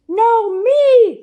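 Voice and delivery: high, loud insistent cry; cry from the hole. A narrator's reading. The trapped sound insisting, crying out loud and high. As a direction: shout it up from the hole. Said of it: "cried the sound".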